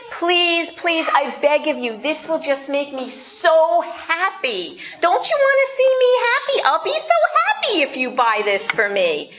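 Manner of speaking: put-on high pleading kid voice, rising to a squeal; whiny begging child. Child pleading with parent in the store, high whiny begging. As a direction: beg like a kid who wants the toy.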